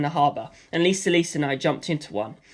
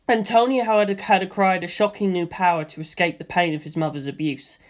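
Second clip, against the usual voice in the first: ordinary delivery, nothing marked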